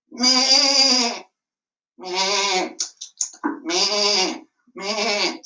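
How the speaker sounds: bleating like a sheep; a lamb bleating. Acted. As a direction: baa out each meh like a sheep.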